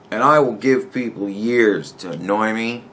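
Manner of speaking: usual speaking voice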